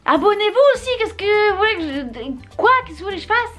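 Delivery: high, pleading and exasperated. Real, not acted. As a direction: throw up your hands at the viewers. Exasperated plea to viewers.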